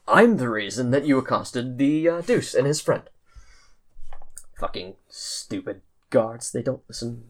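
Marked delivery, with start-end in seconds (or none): 4.6-7.2 bitter muttering. Acted. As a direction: grumble at the guards in disgust. Bitter prisoner muttering about guards.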